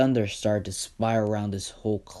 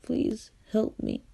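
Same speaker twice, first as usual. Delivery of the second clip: much higher, slow, small pleading voice; crying kid; 13 semitones higher, slower than usual. Acted. Beaten orphan begs Izuku for help, tearful plea. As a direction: beg like a little kid in tears. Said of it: "the kid who's crying".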